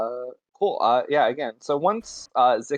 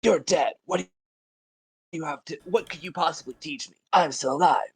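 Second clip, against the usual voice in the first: haughty adventurer; 4 semitones higher than usual. Acted. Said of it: "look at the skull and say".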